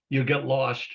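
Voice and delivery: ordinary talk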